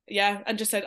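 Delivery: normal talking voice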